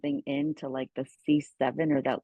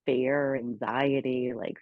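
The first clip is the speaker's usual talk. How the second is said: ordinary talk, nothing marked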